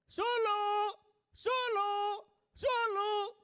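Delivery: high, loud calling up from below. Retold or imitated. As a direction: call up to the window. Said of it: "નીચે બૂમ પાડે".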